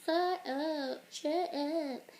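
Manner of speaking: high, drawn-out mock taunt; summoned attackers. People summoned to attack the adult, high mock taunt. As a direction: taunt lightly in a high put-on voice. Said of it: "attack him and be like".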